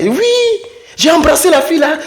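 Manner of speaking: high, fast boast; high quick boast; bragging friend. Acted. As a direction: brag like a friend showing off.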